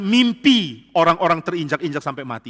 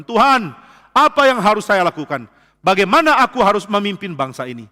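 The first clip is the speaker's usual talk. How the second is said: crying out; 5 semitones higher, 7 dB louder than usual. Acted. Moses crying out to God.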